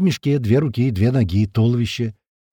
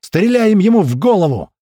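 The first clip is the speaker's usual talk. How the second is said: loud, high combat command; all-out yell; 7 semitones higher, 6 dB louder than usual. A narrator's reading. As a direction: shout the target to the crew. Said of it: "ору, что есть мочи". (громкий боевой приказ)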